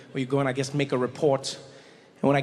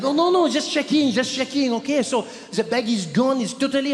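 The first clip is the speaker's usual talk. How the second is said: put-on high French-accented backpedalling; cool French guy; 8 semitones higher, 4 dB louder than usual. French clerk to annoyed traveller, high French accent. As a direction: reassure hastily in a French accent. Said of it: "but very French, very French, cool guy".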